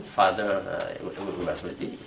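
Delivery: ordinary talk, nothing marked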